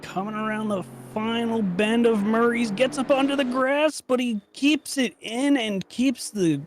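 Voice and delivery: rising, building race call as the car comes round; race play-by-play. Acted. Commentator calling the lap to viewers, building excitement.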